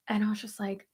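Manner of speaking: ordinary talk, nothing marked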